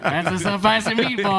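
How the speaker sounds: joking to friends in a hammed-up Italian accent. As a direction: ham up a cartoon Italian chef accent. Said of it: "when [name]'s doing Italian because it involves a spicy".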